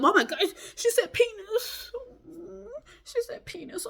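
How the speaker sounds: high, laughing mock-outraged gasp; mock shocked prude. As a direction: imitate a scandalized prude, very high. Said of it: "people get all worked up".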